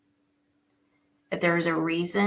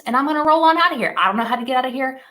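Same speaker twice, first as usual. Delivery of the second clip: louder, higher, fast excited sign-off; excited rush; 7 semitones higher, 9 dB louder, much faster than usual. Real, not acted. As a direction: burst out with it, hurrying to leave. Signing off, excited exit.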